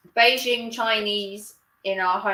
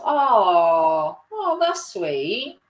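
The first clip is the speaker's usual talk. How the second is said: touched aww; 5 semitones higher, 3 dB louder, much slower than usual. Real, not acted. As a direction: melt at the news, drawn out. Touched by the resident's message.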